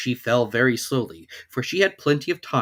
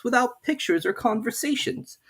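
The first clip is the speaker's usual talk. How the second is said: put-on higher, questioning girl's voice; Alice puzzling to herself, pitched up; young Alice wondering; 12 semitones higher than usual. A narrator's reading. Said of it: "thought Alice".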